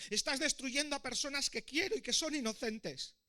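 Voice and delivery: high-pitched impassioned protest; shouting. A narrator's reading. Prince protesting as the dragon burns the village, crying out. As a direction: cry out in high-voiced protest. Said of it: "le gritaba el príncipe".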